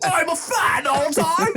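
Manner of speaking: high, slow hammed-up British; overdone British voice through laughter; mock British accent. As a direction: stretch it out in cartoon British. Riffing on the British voice.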